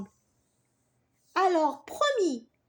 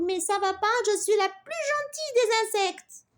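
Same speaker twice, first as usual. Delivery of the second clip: high, loud indignant bee scolding; angry bee; 7 semitones higher, 5 dB louder than usual. Acted. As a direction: rant at the girls in outrage. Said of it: "Zozo s'énerva". (abeille furieuse, voix aiguë et forte)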